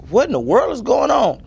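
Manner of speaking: high-pitched bewildered cry, acted out. Singer retelling his three a.m. awakening, bewildered. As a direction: act out waking up baffled, voice shooting up. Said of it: "So it's just like".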